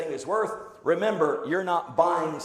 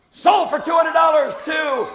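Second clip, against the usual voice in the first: loud, high closing call; triumphant shout; 9 semitones higher, 10 dB louder than usual. Real, not acted. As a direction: bellow the final sale.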